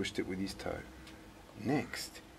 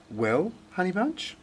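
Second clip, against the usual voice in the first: lifted, fond mum voice; 9 semitones higher than usual. Acted. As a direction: coo it like a loving mother. Mum speaks fondly to Rose.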